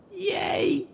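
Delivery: squeaky high cheer; a tiny delighted yay; excited high squeal. A narrator's reading. Character cheers.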